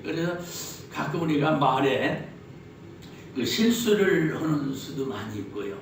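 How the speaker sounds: ordinary delivery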